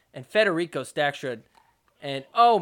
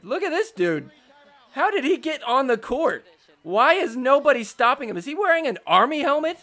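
louder, higher, rushing disbelief; excited disbelief; 6 semitones higher, 6 dB louder than usual. Real, not acted. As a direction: shout out at the sudden streaker. Streaker runs onto the court.

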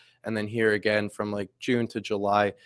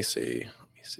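whispering; 11 semitones lower, 10 dB quieter, slower than usual. Real, not acted. Muttering while finding the button.

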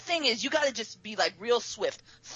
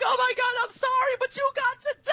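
high, pleading apology; apologetic panic; 14 semitones higher than usual. Retold or imitated. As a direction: plead sorry while panicking. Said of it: "And I'm like".

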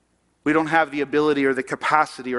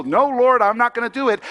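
mildly hammed-up raised protest; stubborn refusal; 9 semitones higher, 6 dB louder than usual. Preacher as balky believer refusing the Lord, mock stubborn. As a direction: dig in, higher and louder, half in jest.

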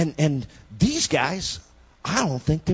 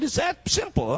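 amazed; 12 semitones higher than usual. Retold or imitated. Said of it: "I said".

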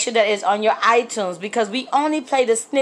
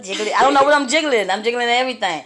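ordinary delivery

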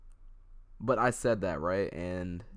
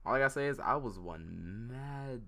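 ordinary talk

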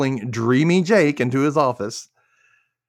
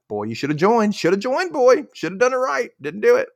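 teasing taunt; 10 semitones higher than usual. Retold or imitated. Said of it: "And I'm like".

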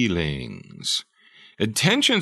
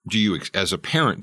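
ordinary talk

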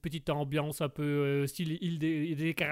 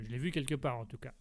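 normal talking voice

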